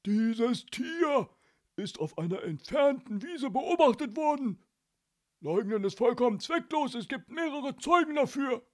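loud, high accusing policeman; menacing shouted accusation; threatening. A narrator's reading. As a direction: hammer home the charge. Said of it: "bedrohlich auf Snök".

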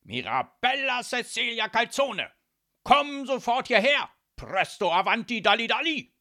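furious high-pitched full-volume summons; Don Calzone screaming. A narrator's reading. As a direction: yell it as loud as you can, demanding instant obedience. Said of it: "schrie Don Calzone so laut er nur konnte".

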